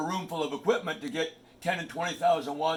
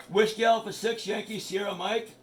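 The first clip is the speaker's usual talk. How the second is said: radio call sign; 4 semitones higher than usual. Shown as a demonstration. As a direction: hail the net over the air. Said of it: "This is how it works".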